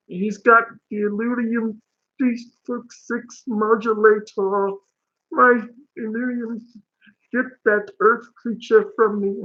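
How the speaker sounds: high-pitched cartoon alien; Marvin the Martian. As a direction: play an indignant little cartoon alien. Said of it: "Marvin the Martian".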